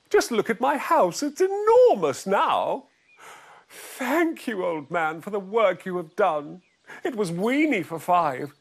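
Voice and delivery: put-on high, delighted old woman; old lady voice. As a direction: beam it like a relieved old lady.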